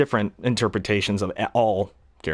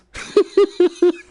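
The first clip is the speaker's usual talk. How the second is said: high loud Joker cackle; maniacal laugh; 5 dB louder than usual. Retold or imitated. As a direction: cackle like a crazed clown. Joker laugh after sinister lines.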